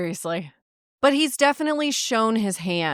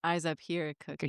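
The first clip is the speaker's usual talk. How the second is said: low sultry quote; 8 semitones lower, 11 dB quieter than usual. Retold or imitated. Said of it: "And he says".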